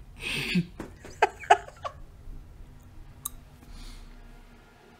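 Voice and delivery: soft, drawn-out chuckling laugh; long laugh. Real, not acted. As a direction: laugh quietly to yourself. Laughing after own joke.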